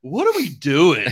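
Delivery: mock-incredulous player, loud and drawn out; baffled protest. Star quarterback questioning his coach's odd plays, mock incredulous.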